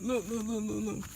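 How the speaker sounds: ordinary delivery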